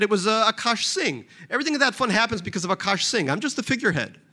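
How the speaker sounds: lightly voiced wheedling rich man; a touch of sheepish pleading; hint of Barry. Barry asking Jeff for a loan, lightly voiced.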